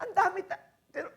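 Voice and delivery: ordinary talk, nothing marked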